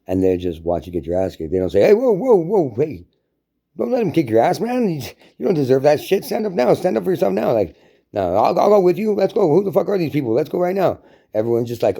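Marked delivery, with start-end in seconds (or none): 1.8-7.5 high-pitched, hammed-up bystander stepping in; mock urgent rallying call; urgent intervening. Acting out bystander stepping in to help. 8.1-11.0 high-pitched acted call to action; rally a friend for a fight; hammed-up loyal backup; eager rallying. Acting out ally eager to fight.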